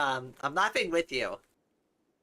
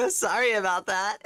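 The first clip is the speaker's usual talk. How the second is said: laughing apology; 5 semitones higher than usual. Real, not acted. Apologizing after jump scare, laughing.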